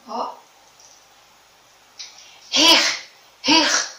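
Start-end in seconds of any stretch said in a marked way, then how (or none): 2.5-3.9 "Rir" loud, both R's rasped hard; strong R demo. Shown as a demonstration. Teacher loudly sounding out "rir" for pupils. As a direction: punch the R at both ends of "rir". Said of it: "O R forte tá em dois lugares".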